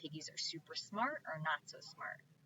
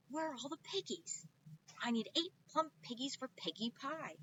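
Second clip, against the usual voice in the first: high demanding witch voice; 8 semitones higher than usual. Acted. Witch Gritch demanding pigs from the duck, high voice. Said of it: "Gritch shouted to a duck".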